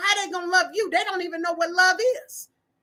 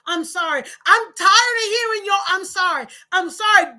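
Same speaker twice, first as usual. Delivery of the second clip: louder, exasperated complaint; fed-up partner; 4 semitones higher, 5 dB louder than usual. Retold or imitated. Partner fed up with hollow apologies, exasperated. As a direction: snap back tired of the apologies. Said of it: "Didn't you just say that".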